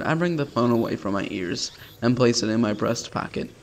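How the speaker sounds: ordinary talk, nothing marked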